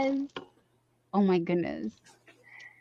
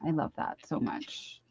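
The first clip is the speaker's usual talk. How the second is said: whispered gush; 6 semitones lower, 15 dB quieter than usual. Real, not acted. Gushing about a YouTuber.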